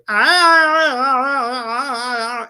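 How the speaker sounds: high, loud, strained wailing ah sounds; mock wailing healer. Doctor Lonnie at the therapy session, hammed-up high wailing cries. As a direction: wail out a fake healing cry, high and loud. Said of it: "he literally wrote".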